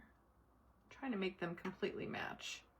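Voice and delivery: usual speaking voice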